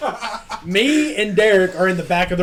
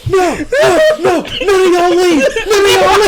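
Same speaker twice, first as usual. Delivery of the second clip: higher, louder panicky Derek; imitating Derek panicking; 12 semitones higher, 8 dB louder than usual. Mimicking Derek panicking in the water, higher and louder. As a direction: squeal it like he's freaking out. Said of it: "And he goes".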